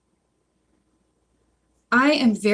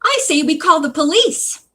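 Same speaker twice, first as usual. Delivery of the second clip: high-pitched indignant shopkeeper; shrill it out as an outraged woman; raised, piercing character voice; 7 semitones higher, 5 dB louder than usual. A narrator's reading. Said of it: "the woman shrilled".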